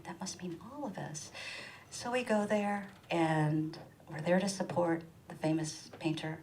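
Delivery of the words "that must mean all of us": hushed conspiratorial whisper. Retold or imitated. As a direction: whisper it like decoding a secret. Decoding mother's letter.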